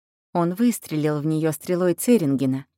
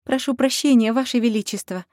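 higher, louder contrite plea; urgent apology; 6 semitones higher, 3 dB louder than usual. Acted. Heroine bows apologizing.

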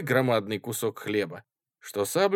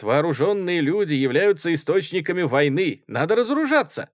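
loud, raised-pitch oratory; impassioned speechmaking; 7 semitones higher, 7 dB louder than usual. A narrator's reading. Student reporting to comrades on disarmament, orating. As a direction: address the meeting with force. Student orates in heated debate.

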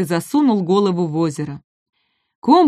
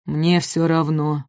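apathetic giant; 3 semitones lower, slower than usual. Acted. Апатозавр равнодушно отворачивается. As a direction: let it fall flat and weary. Apatosaurus voiced slow.

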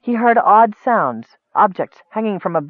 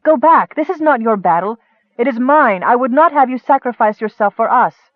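high, insistent protest; urgent plea; 6 semitones higher, 3 dB louder than usual. A narrator's reading. Nicole refusing the cleric's sacrifice, urgent and high. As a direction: push him away with a raised voice. Nicole begs Michael to turn back.

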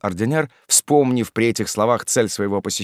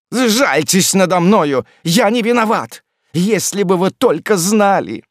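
loud, high-pitched begging; desperate cry; 10 semitones higher, 6 dB louder than usual. A narrator's reading. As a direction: cry out for mercy, pleading innocence. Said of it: "вскричал злополучный смотритель". (отчаянная громкая мольба)

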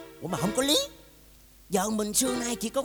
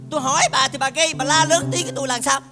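loud, raised-pitch complaint; angry scolding; 5 semitones higher, 9 dB louder than usual. Acted. Husband scolding his quarrelsome wife, loud and angry. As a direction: berate her at high volume. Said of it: "ông la rầy tôi".

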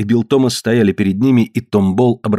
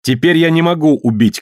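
higher-pitched proclamation; declaring cry; 6 semitones higher than usual. A narrator's reading. Dikar has thrown the gun away, raised declaration. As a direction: call out to everyone, moderately. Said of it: "крикнул Дикар".

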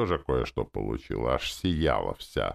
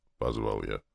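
plain reading voice

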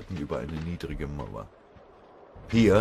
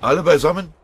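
sergeant's high, loud yell; shouting; 12 semitones higher, 13 dB louder, faster than usual. A narrator's reading. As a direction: shout the question at your men under fire. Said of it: "schrie ihn der Oberfeldwebel an". (laut angeschrien)

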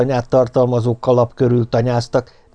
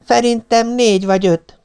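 put-on little child voice; 11 semitones higher than usual. A narrator's reading. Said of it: "vélekedett a kicsi".

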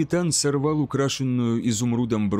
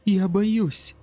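put-on higher-pitched voice of a fearful girl; a trembling girl confessing fear, lightly acted; frightened girl; 7 semitones higher than usual. A narrator's reading. Trembling girl clinging to her lover, frightened. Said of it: "дрожащей рядом с ним девушке".

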